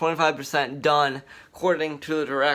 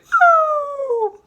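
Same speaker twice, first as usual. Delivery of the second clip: loud, very high-pitched "woo"; drawn-out falsetto whoop; 9 dB louder than usual. Acted. Repeats the whoop.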